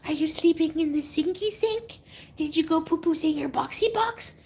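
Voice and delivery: put-on high cooing baby talk; baby talk to the cat. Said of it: "I always say things twice".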